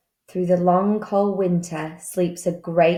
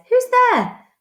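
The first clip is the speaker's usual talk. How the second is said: high, louder startled squeak; mouse cries out; 5 dB louder than usual. Acted. Mouse hears a stranger in the den, high startled cry. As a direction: call out into the dark like a tiny mouse. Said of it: "Mouse cries".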